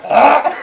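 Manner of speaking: high laughing shriek; laughing yelp. Retold or imitated. Retelling the hospital gross-out, a laughing yelp.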